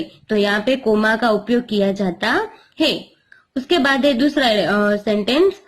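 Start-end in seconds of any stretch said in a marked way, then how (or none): none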